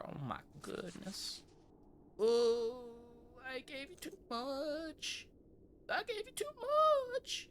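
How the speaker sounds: high, breathy fretting whimper; mock distressed squeak; flustered panic. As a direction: fuss like you just overpaid in a panic. Flustered shopkeeper overpaid.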